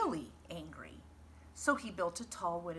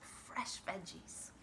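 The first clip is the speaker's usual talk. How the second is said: hushed; 9 dB quieter, slower than usual. Acted. Farmer savouring veggies.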